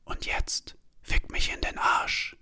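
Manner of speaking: slow, breathy-free unvoiced whisper. A narrator's reading. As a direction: whisper it slowly and intimately. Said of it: "Da flüstert sie plötzlich". (langsam geflüstert)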